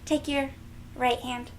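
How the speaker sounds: ordinary delivery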